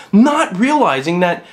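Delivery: ordinary delivery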